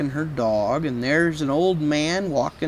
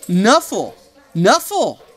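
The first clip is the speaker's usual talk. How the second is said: insistent call; 4 semitones higher, 5 dB louder, slower than usual. Acted. Sonia claiming her bunny.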